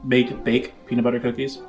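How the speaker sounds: ordinary delivery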